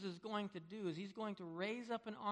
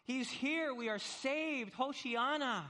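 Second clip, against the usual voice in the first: high, loud crowd rejoicing; triumphant, raised-voice celebration; jubilant; 6 semitones higher, 5 dB louder, slower than usual. Acted. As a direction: proclaim it as an overjoyed crowd. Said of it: "the people are jubilant".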